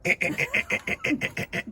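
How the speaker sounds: breathy sputtering engine imitation; starter noise. Rider retelling the bump-start trouble, mock engine sputter. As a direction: sputter it out, trailing into a laugh. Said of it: "and I'm like, it was like".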